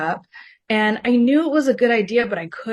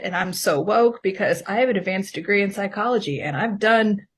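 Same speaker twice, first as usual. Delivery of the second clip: usual speaking voice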